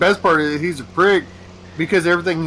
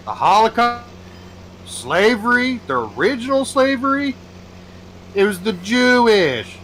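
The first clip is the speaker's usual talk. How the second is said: reach for the words, pitched up; mock clueless woman; 9 semitones higher than usual. Woman guessing at history, higher and halting. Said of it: "She goes".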